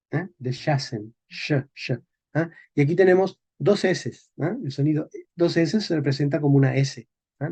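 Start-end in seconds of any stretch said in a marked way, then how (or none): 0.4-1.9 Deshasen as de-SHA-sen, then sh alone; medieval pronunciation. Shown as a demonstration. Teacher drilling for students, sh pronunciation. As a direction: isolate the sh softly after Deshasen. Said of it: "el sonido sh".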